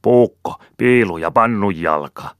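loud, high sharp bark. A narrator's reading. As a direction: bark out three hard words. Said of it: "karjaisi kolme kovaa sanaa".

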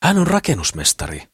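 high-pitched excited exclamation; exclaiming. A narrator's reading. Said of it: "huudahti Mimmi Nelmalle". (huudahtaen korkealla äänellä)

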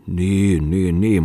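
drawn-out mock agreement; mocking mimicry. A narrator's reading. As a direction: mimic him derisively on each repeated word. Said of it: "matki häntä Muttinen". (pilkallisesti matkien)

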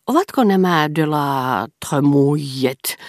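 slow, loud, every word of "Ovatko nämä de la Tremouillet" pronounced forcefully; forceful pronunciation. A narrator's reading. Scholar quizzing the dinner table, forcefully enunciating. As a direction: enunciate it with weight. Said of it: "kysyi Brichot voimallisesti ääntäen".

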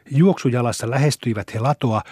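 plain reading voice

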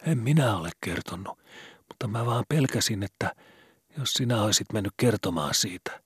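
put-on a scared boy asking in the dark; worried boy Skobe. A narrator's reading. Skobe voiced higher. (huolestunut poika)

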